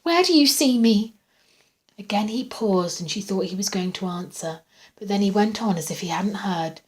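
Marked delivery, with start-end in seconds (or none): 0.0-1.1 high-pitched, loud alarm; fearful. A narrator's reading. Patient asking where he watches her, fearful. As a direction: demand it, frightened. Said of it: "she asked fearfully".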